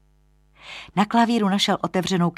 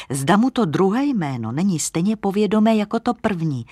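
usual speaking voice

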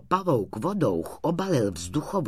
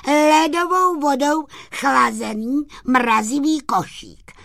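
high, loud pitchman voice for a little sprite; sprite's proud, high-pitched sales patter; boastful little sprite; 8 dB louder than usual. Acted. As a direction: show off your invention in a high, ringing voice. Story figure pitching his invention.

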